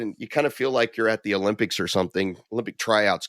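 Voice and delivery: usual speaking voice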